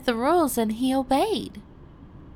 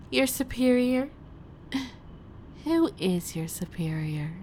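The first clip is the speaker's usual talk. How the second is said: usual speaking voice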